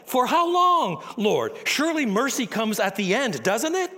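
high, loud pleading cry; anguished cry. Acted. Isaiah crying out to God in loud anguish. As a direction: cry it up to heaven in despair.

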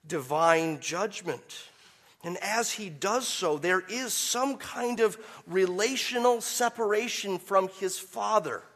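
usual speaking voice

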